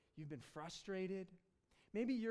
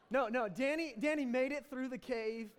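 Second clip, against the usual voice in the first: laughing, louder and higher; 5 semitones higher, 7 dB louder than usual. Real, not acted. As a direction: laugh it off after the joke. Laughing after the joke.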